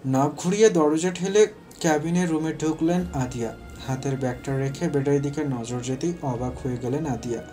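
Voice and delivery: ordinary delivery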